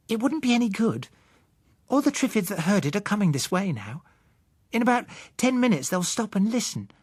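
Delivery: put-on high child voice explaining patiently; knowing girl. Susan lecturing the adults on triffids, high child voice. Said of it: "Susan explains triffids".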